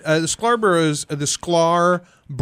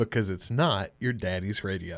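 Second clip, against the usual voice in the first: quiet; 8 semitones lower, 8 dB quieter than usual. Shown as a demonstration. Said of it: "You wanna do the intro?".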